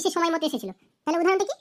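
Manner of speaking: ordinary talk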